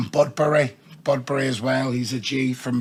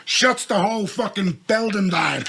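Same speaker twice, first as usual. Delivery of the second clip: normal talking voice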